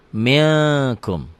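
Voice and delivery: MILKUM as "ming-kum", long ng; held ikhfa. Shown as a demonstration. Teacher repeats the correct reading, held ng. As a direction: hold the hidden n before KUM. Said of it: "Dibacanya jadi milkum".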